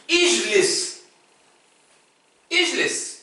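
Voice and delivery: ijlis said slowly with a clear j and final s, as to a learner; Arabic word ijlis. Shown as a demonstration. Teacher models the word for sit down to students. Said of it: "je dis".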